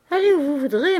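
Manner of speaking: loud, high dismissal; offended retort. A narrator's reading. Landlady retorts to the threat.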